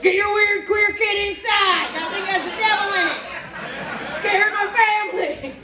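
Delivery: mock angry neighbor. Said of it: "They're like".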